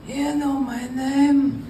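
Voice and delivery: slow, drawn-out hint of Sicilian mob accent; menacing Sicilian accent, savoring each word. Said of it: "Italian slash Sicilian accent".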